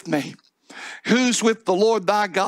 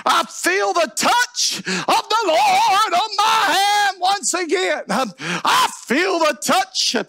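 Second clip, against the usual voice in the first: high, slow preacher's shout; exultant cry; 11 semitones higher, slower than usual. Acted. Samson tells the boy God's touch is back, exultant cry. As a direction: stretch each word into a triumphant holler.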